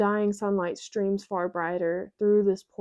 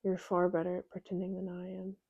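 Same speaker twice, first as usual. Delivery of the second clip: soft, murmured confession; quiet murmur; 9 dB quieter than usual. Acted. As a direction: confess it under your breath. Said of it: "My voice is a murmur, a quiet confession".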